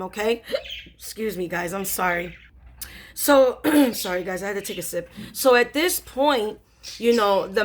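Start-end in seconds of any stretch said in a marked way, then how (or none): none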